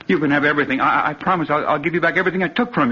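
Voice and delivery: panicked begging. Acted. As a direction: gabble promises in terror. Begging the killer for his life.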